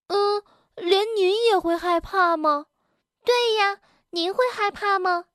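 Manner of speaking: put-on high childlike voice, full of disbelief; astonished. A narrator's reading. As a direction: ask in wide-eyed kid surprise. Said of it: "惊讶地问". (孩子般高声惊讶地追问)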